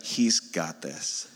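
calm passenger trusting the captain, slow and soft; totally at peace. Retold or imitated. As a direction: murmur it, calm and sure. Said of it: "she's totally at peace".

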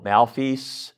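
"Malfeas" said slowly in two syllables, MAL-feece; "Malfeas" with a long ee in the last syllable, slowly; variant pronunciation. Shown as a demonstration. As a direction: draw out "Malfeas" as a tentative try at the word. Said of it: "I pronounce this, like, six different ways".

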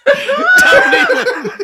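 shouting through real laughter; laughing shout. Real, not acted. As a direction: burst out happy, laughing loudly. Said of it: "I'm a kind of fat happy".

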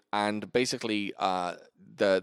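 usual speaking voice

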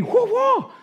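high whoop noises for blocks; playful high whoops, laughing; mock whoosh sounds. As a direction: sound out the swings. Mimicking blocking moves.

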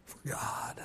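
sudden hushed whisper. Acted. Preacher whispering the question to the crowd. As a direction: drop it to a breath.

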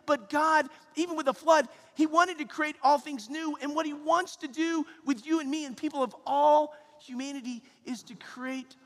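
high, loud impassioned preaching. Acted. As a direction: build to a climax. Preaching climax.

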